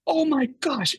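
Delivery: high-pitched, stunned exclamation; high gasp-like wonder, retold; retold amazement. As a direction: act out being floored by a coincidence. Retold shared amazement at the coincidence.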